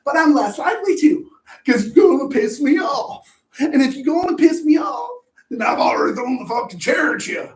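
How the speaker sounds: loud, high, heated yelling; angry shout. Acted. Speaker shouting at the person who angered him, angry. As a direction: blow up like you're about to hurl the chair. Venting about throwing a chair.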